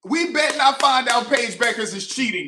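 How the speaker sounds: high, loud, half-joking charge; loud accusation. Real, not acted. Fan yelling a joking cheating charge at the player.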